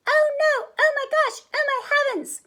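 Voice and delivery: put-on tiny high-pitched baby bear squeal; wee little voice. A narrator's reading. Baby Bear squeals in the kitchen, wee panicky voice. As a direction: cry out loud and very high. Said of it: "he cried in his wee little voice".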